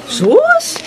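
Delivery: high mocking scoff; playful high-pitched scoff; teasing scoff. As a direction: brush off the flattery with a squeal. Teasing dismissal of flattery.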